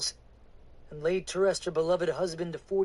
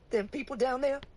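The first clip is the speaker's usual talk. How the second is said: higher Southern woman's voice, faltering; frightened woman; 11 semitones higher, 3 dB louder than usual. Acted. Woman asking the preacher about her people, high and faltering. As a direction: ask it then catch yourself.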